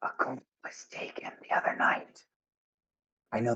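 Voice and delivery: secretive, voiceless whisper. Acted. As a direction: lean in and whisper it like dangerous news. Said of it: "she whispers and she goes".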